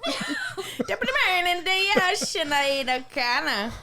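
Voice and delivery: high, laughing mock Irish brogue; hammy stage Irish, slowing at the end; Irish accent. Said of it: "Irish accent".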